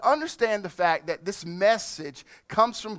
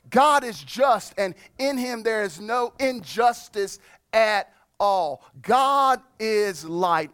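louder, slow, punching each "God is"; preaching; 3 semitones higher, 4 dB louder than usual. Acted. Pastor driving home God's holiness to the church, preaching. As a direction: preach it, building line by line.

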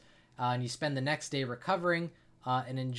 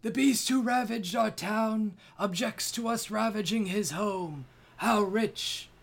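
higher and louder, sneering and aggressive; aggressive elder; 9 semitones higher, 6 dB louder than usual. Acted. The Elder snaps back at the dragon, aggressive and sneering. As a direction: spit it back at the dragon with scorn. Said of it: "responds equally aggressively".

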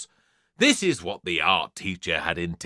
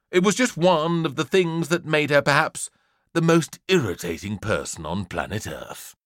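usual speaking voice

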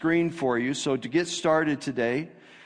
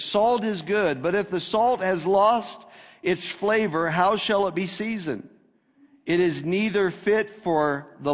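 higher, slower solemn proclamation; scripture reading; 4 semitones higher than usual. Acted. Pastor reading Jesus' words to the congregation, high solemn proclamation. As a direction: proclaim the verse to the whole church.

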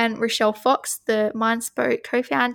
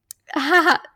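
brief high chuckle; a quick amused laugh; light little laugh; short laugh; 5 semitones higher, 3 dB louder than usual. Real, not acted. Amused by the card tagline.

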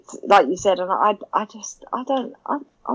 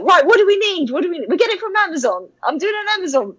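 much higher, bright self-quote; higher, eager self-mockery; eager shopper; 10 semitones higher, faster than usual. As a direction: play your keen online-shopping self. Acts herself ordering.

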